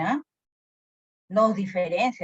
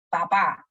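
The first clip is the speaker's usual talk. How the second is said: "Papá" said pa-PÁ; stress on last syllable; 2 semitones lower, 3 dB louder than usual. Shown as a demonstration. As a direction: land firmly on the end of "Papá". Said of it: "un acento agudo". ("Papá" con acento agudo, en la última sílaba)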